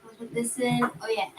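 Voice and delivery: ordinary talk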